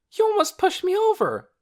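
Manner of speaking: put-on high, loud girl's protest; cried out. A narrator's reading. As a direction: yell at him to stop. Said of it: "cried Lena".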